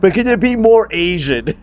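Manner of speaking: mock casting director. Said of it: "And they go".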